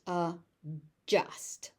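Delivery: "adjust" as a slow "uh", then "just"; vanishing D. Shown as a demonstration. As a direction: split "adjust" in two, no separate D heard. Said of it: "You notice that D just vanishes".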